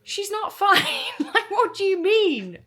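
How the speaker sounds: loud, high-pitched retold protest with a laugh; outraged disbelief. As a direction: burst out in exasperated mock outrage. Acted outburst at the nurse's news.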